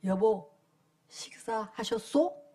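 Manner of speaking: stiff polite husband. Retold or imitated. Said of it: "뭐라 그러냐면".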